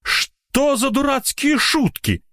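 high, drawn-out outraged cry without much volume; sudden yelling. A narrator's reading. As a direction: break into a high-pitched angry cry. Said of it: "Неожиданно перешёл он на крик".

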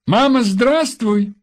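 put-on high, drawn-out child's hello; child's joyful greeting. A narrator's reading. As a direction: call out to mum like an excited little boy. (радостный детский голос)

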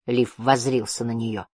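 plain reading voice

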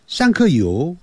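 "thank you" in heavy Mandarin accent; mock Chinglish "thank you" in Mandarin syllables; Chinese-accented thank you. Shown as a demonstration. Teacher warns learners what not to say, Mandarin-accented English. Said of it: "千万不要说上课有".

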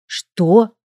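taken aback, disbelieving; stunned. A narrator's reading. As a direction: ask as if floored. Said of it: "ошеломлённо спросила я".

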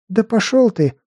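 high furious snap; angry. Acted. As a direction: spit it out in rage. Girl cursing the necromancer.

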